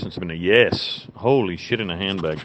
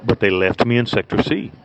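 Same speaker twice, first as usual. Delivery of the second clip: ordinary delivery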